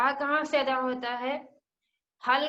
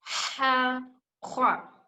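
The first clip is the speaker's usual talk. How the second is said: slow, drawn-out Quranic teaching recitation; careful Arabic recitation; much slower than usual. Shown as a demonstration. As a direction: recite with long vowels, each letter set apart by pauses. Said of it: "एक बार फिर से repeat करती हूँ".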